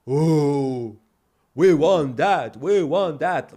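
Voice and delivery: slow, loud, dopey mock agreement; mocking gullible people. Mocking gullible people who want the news, a loud dopey mock. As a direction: play the sheep who swallow it all. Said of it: "While people is like".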